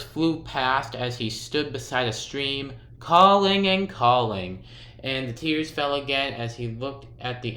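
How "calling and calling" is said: ordinary delivery, nothing marked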